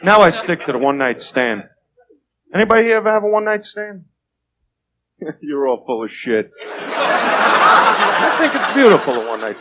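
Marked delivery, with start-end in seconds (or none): none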